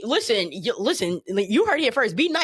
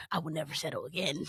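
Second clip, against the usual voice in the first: low, soft solemn vow; quiet vow; 7 semitones lower, 12 dB quieter than usual. Retold or imitated. Vowing after a breakup, low and soft. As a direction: murmur it like a private promise.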